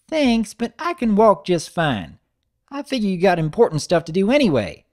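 put-on high, eager little-girl voice; little sister greeting her big brother brightly; young filly voice. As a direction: bounce it up high like an excited kid. Narrator voices Apple Bloom.

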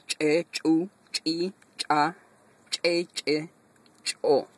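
"Che, chu, chi, cha" with an ejective, popped ch; explosive ch syllables. Shown as a demonstration. Teacher drills the ejective CH row, popping each syllable. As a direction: burst out each "ch" like a little explosion. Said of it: "Este che tiene una explosión".

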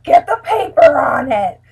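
slow, loud exasperated yell; frustrated shout. Retold or imitated. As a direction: bark it out, fed up. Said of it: "I'm just like".

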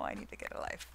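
whispered self-mocking aside; whispered embarrassed aside. Real, not acted.

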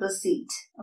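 "റിസീറ്റ്" with silent P; correct pronunciation. Shown as a demonstration. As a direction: say "റിസീറ്റ്" as re-SEET. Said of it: "ഇവിടെ പി silent ആണ്". ("റിസീറ്റ്" ശരിയായി, "പി" ഇല്ലാതെ)

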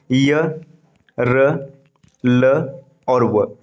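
य, र, ल और व each said slowly and apart; slow letter listing. Shown as a demonstration. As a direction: count off the letters one by one. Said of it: "कौन कौन है". (धीमे से अक्षर गिनाना)

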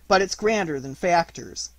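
usual speaking voice

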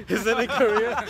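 high, loud laughing repeat; laughing echo. Retold or imitated. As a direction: repeat the question through laughter. Echoes question, laughing.

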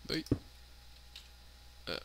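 ordinary talk, nothing marked

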